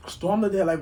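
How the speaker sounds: ordinary delivery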